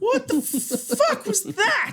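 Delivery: slow, high disbelief; incredulous. Real, not acted. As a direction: stretch it out, stunned and baffled. Baffled reaction to finish.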